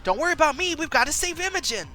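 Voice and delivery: urgent. Acted. Injured friend urging a rescue.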